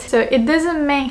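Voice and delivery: usual speaking voice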